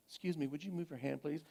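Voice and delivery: quiet, quick, overly polite request; clipped-back courtesy masking rage; forced politeness. Retold or imitated. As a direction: imitate a daughter holding in anger, politely and softly. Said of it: "being polite at the same time".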